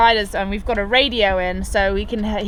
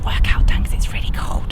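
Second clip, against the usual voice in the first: whispering. Real, not acted. Whispering aside before workout.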